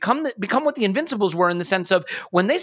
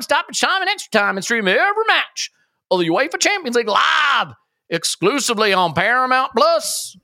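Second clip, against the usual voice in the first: high, excited announcer voice for a sponsor spot; pitching soccer on Paramount Plus in a high hyped voice; hyped ad read; 7 semitones higher than usual. Acted. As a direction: sell it like an over-the-top TV promo.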